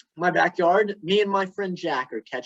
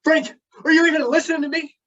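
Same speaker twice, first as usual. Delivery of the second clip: fast, higher, louder snap; snapping wife; 9 semitones higher, 5 dB louder, much faster than usual. Retold or imitated. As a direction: snap at him for not listening. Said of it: "And Cindy snaps".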